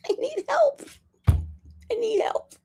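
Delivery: a hammed-up plea, slow and high; high mock wail. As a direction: cry out for help in a high, stretched voice. High wailing plea, laughing.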